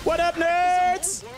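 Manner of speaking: high, slow drawn-out taunt. Pretend. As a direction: gloat at the enemies. Taunting on the bike.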